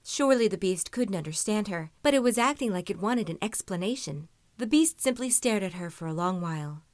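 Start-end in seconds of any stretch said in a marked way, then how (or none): none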